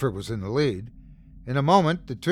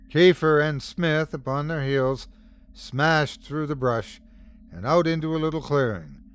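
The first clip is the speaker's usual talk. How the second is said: ordinary delivery, nothing marked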